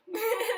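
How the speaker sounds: high laugh-cry burst; real emotional laugh-sob; teary laugh. Real, not acted. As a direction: laugh through welling tears. Laugh-cry after giving sandwich.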